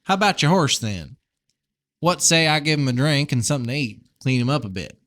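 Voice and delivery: put-on folksy Southern-accented farmer; amateur Southern twang. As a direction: chat like a friendly Southern neighbour. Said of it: "really bad Southern accent".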